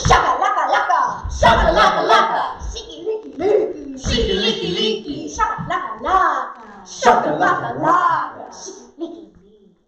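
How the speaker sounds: sing-song nonsense, swooping about; playful word play. Shown as a demonstration. Drama teacher warms up imagination, playful nonsense voices. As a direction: play with each silly sound in a new voice.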